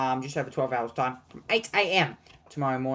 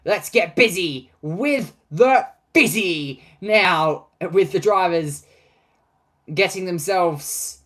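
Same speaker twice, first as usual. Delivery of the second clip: louder, slow, drawn-out hype call; hyped announcer; 3 semitones higher, 6 dB louder, slower than usual. Acted. Streamer hyping the podium champagne spray to his viewers, loud slow announcer hype. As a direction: sell the champagne moment like a big crowd show.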